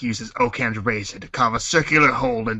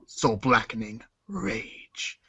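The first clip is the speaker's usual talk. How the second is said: soft, very slow ominous phrase; dramatic slow menace; 6 dB quieter, much slower than usual. Acted. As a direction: stretch it out darkly.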